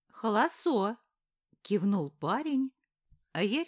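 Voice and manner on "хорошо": put-on small boy lisping his r as l; a cheerful toddler saying it with l for r; lisping child. Little boy lisps okay.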